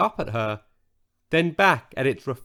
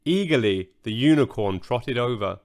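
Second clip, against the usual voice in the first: plain reading voice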